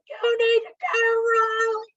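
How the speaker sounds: high, loud retold yell at the child; imitating a scream. Parents losing it with their five-year-old, high loud mock scream. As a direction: shriek it like a parent at the end of her rope. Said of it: "we're screaming at her".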